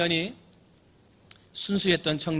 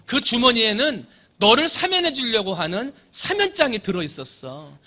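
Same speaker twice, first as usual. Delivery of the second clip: scolding jailer; 6 semitones higher, 5 dB louder than usual. Acted. Jailer scolds the foolish prisoner.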